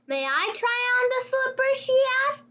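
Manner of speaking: plain reading voice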